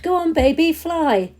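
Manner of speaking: loud high taunt, half laughing; jeering yell. A narrator's reading. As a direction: goad her to jump, loud and high. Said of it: "They laughed".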